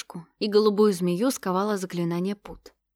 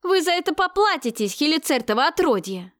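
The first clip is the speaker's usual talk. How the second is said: angry; 7 semitones higher, 5 dB louder than usual. A narrator's reading. Captive princess curses captors.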